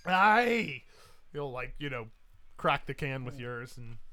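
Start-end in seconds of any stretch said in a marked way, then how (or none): 0.0-0.8 high, booming Scottish "Aye"; hearty brogue of approval; Highland Scot. Acted. Scot answers the rum-giver, loud hearty Highland cheer. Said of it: "this world's equivalent of a Highland Scot".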